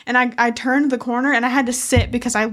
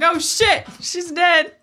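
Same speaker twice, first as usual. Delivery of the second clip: higher, comic shocked discovery; mock alarm; 6 semitones higher, much slower than usual. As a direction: play the startled hiker for laughs. Acts out friends' alarm on turning.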